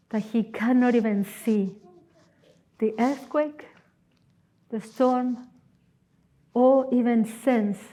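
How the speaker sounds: normal talking voice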